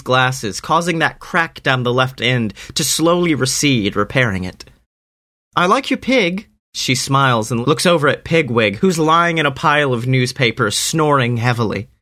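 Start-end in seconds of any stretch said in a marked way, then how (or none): 5.6-6.5 put-on high girl voice. Harmony speaking.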